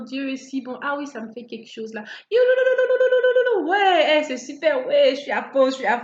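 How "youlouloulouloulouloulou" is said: high, loud mock ululating cry; ululation. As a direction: trill out a joyful ululation. Mock ecstatic worshipper.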